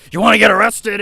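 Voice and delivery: high, loud barked threat; officer yelling. Retold or imitated. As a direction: imitate the riot officer shouting a threat. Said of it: "he's like".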